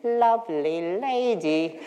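slow, leering mock villain with a laugh; a playful, hammed-up Les Mis character. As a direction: drawl it like a creepy old-time tooth puller. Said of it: "It would be just like Les Mis".

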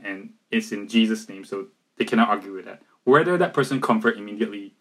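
ordinary talk

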